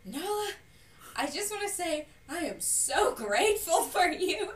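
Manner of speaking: high, loud, slow mock-sincere sister voice; mock gushing sister. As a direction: ham up the sister's sudden gratitude, laughing through it. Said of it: "she goes".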